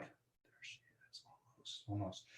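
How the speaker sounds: hushed unvoiced whisper, drawn out. Real, not acted. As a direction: whisper as if not to disturb the pot. Waiting for pot to simmer.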